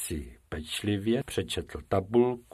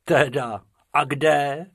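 doubtful; 5 semitones higher, 3 dB louder than usual. A narrator's reading. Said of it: "zeptal se pochybovačně Bob".

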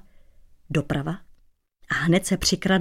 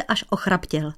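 plain reading voice

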